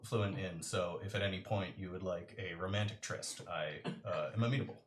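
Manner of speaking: low, soft, formal deadpan proposition; stiff Choice. Acted. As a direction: offer a tryst with total detachment. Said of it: "he looks up at you and goes".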